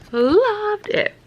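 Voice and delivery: high excited gush. Real, not acted. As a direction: squeal it with delight. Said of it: "I loved the first book".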